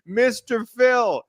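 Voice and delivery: high, whiny mock begging; mock pleading crew. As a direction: beg like terrified helpless crew. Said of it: "they go crawling on their hands and knees".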